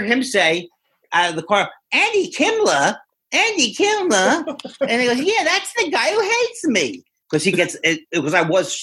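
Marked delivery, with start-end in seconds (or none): 1.9-4.4 high, slow, puzzled host voice; Leno calling out. Retold or imitated. Leno hears the name from his car, high and slow. 5.2-7.0 high, put-on host voice; mock the host's whiny high reply; Leno voice. Leno answers the fan, in a high put-on voice.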